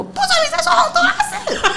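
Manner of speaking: hammed-up angry girlfriend, cracking up; mock outburst. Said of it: "那女生突然发飙说".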